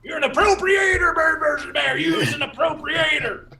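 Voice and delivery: high, loud accusing shout; shouting down the podcast; mock accusation. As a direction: yell it like an offended critic. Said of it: "they say, uh".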